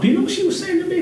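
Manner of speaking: high lightly imitated wife voice; wife voice carried on. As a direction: keep the high wife voice going. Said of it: "still in wife voice".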